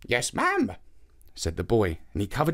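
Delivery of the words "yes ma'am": put-on higher, polite young boy; boy's voice. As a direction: greet her as a polite boy. Boy obeys old woman.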